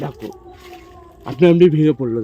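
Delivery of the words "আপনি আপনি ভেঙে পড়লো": loud startled cry; surprised exclamation. Real, not acted. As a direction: blurt it out in shock. Tree falls by itself. (অবাক হয়ে চেঁচিয়ে ওঠা)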